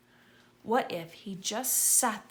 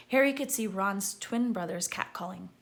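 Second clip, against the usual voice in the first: ordinary delivery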